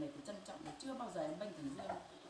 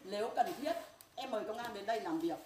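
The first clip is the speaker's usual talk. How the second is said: angry threat; 4 semitones higher, 6 dB louder than usual. Real, not acted. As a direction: spit out each word of the threat. Mother threatening to call police.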